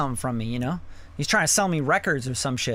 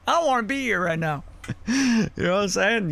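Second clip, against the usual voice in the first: laughing through the words, a bit higher; 5 semitones higher than usual. Real, not acted. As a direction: chuckle it out in mock dismay. Laughing at the hellfire sermon.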